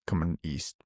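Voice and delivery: ordinary talk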